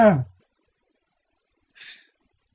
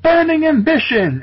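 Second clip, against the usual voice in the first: much higher hammed-up yell of the attack name; battle cry; 12 semitones higher than usual. Hero slashing a monster, hammed-up battle cry. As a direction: cry it out like a sword-swinging hero. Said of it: "He yelled".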